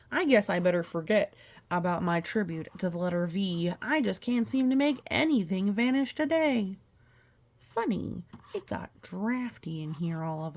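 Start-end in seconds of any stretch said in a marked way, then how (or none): none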